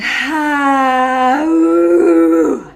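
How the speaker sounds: big voiced sigh, full release; loud open sigh. Shown as a demonstration. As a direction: let a loud sigh pour out. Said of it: "Make sound".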